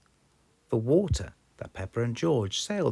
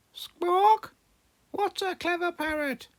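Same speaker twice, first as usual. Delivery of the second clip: very high squawking parrot mimicry; 5 dB louder than usual. A narrator's reading. Polly copying Granny Pig, squawking very high. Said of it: "Polly copied".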